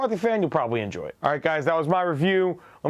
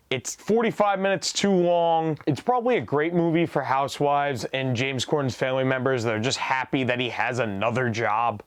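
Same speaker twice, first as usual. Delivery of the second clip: ordinary delivery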